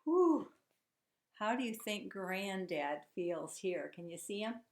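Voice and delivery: normal talking voice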